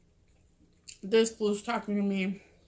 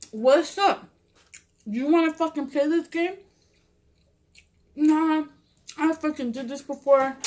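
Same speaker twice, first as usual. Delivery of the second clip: retold challenge, pitched up; confrontational demand; 5 semitones higher than usual. Vato demands she choose him or the other man, challenging imitation. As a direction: act out a pushy ultimatum.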